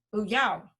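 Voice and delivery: "Bu yao" with a falling "yao"; fourth-tone repeat. Acted. Newcomer repeating after the guide, "Bu yao" falling tone. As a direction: copy the tone, letting "yao" drop. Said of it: "fourth tone".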